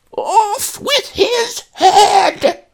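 very high, loud, slow cackling roar; roaring matriarch. Acted. Tyrannical ancestress shouting from the garden, shrieking and cackling. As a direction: bellow it like a mad queen. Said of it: "roared the ancestress".

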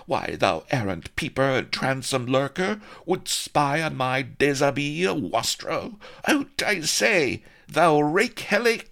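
high, loud indignant damsel; outraged lady. Acted. Vanity berating Blood for spying on her, outraged and high. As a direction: scold the peeping wastrel. Vanity scolds in archaic speech.

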